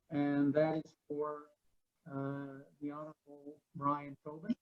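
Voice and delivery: faint and far, dropping out; fading in and out. Real, not acted. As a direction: voice drifting away from the mic. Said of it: "your voice is fading in and out".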